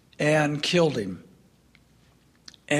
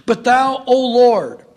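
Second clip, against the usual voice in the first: higher, louder, reverent proclamation; psalm reading; 6 semitones higher, 5 dB louder than usual. Real, not acted. Preacher reads the psalm's turn to God, reverent and rising. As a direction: lift it up to the Lord. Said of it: "So let's just read it".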